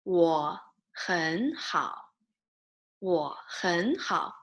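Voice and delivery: "我很好" drawn out word by word; slow model phrase. Shown as a demonstration. Teacher showing how to answer a greeting, slow model phrase. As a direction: stretch each syllable of "我很好" for learners.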